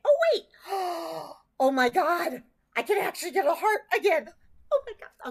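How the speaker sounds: gasp, then rush out the joy; thrilled her finger can bend again; real excitement. Real, not acted. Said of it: "I just got excited".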